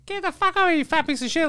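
high mock kid voice cursing; a lightly voiced bratty boy swearing. Ten-year-old driver swearing at the passenger, high mock kid voice. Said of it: "he was swearing at me".

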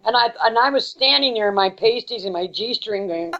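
ordinary talk

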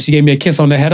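ordinary talk, nothing marked

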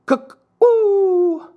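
very high, long hooted taunt; mocking cuckoo call. A narrator's reading. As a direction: jeer with a loud falsetto bird call. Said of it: "mocking them with his thumb to his nose".

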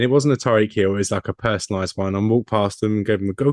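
usual speaking voice

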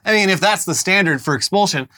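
ordinary talk, nothing marked

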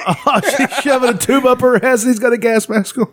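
high-pitched, laughing through the words; laughing disbelief. Real, not acted. Host describes an absurd video to co-host, laughing in disbelief. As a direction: crack up while describing it.

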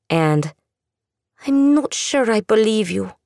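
put-on young woman's voice. A narrator's reading. Andula voiced, refusing.

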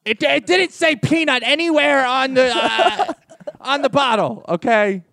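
high, indignant mom complaint; outraged parent. Pretend. Parent griping to the company about peanuts, high and indignant. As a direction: play the fussy mother who's annoyed.

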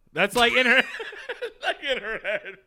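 words broken up by laughter; cracking up at his own joke; laughing. Real, not acted. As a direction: laugh through the punchline.

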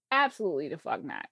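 normal talking voice

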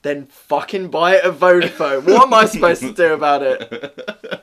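loud angry retort; exasperated outburst. Retold or imitated. Assistant telling customer to go to Vodafone, loudly exasperated. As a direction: blow up at the customer, volume rising.

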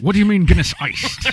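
loud, high mock-British query; mock British accent. Co-host mimics the pub exchange, mock British accent. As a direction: throw it out as an incredulous cartoon Brit. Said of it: "the accent".

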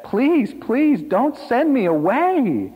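put-on high, loud, drawn-out child's plea; wailing appeal, stretched out; desperate begging. A narrator's reading. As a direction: plead like a frantic little boy, slow and high. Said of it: "he begged".

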